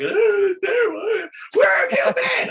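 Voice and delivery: put-on high, loud, crying drunk shout; drunken wailing. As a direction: wail it out like a tearful drunk friend at the pub. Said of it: "everybody was wailing and drunk".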